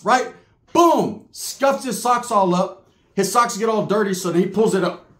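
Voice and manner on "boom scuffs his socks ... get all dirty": ordinary talk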